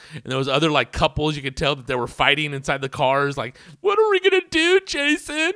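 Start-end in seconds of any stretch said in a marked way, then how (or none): 3.8-5.5 much higher, whiny pleading; mock girlfriend. Girlfriend pleading with Jason in stuck car, high whine. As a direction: squeak it out like a frantic girlfriend. Mocking couple fighting in car.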